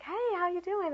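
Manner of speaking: high-pitched chirpy greeting; acted friendly hello; cheery small talk. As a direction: greet them brightly while bluffing.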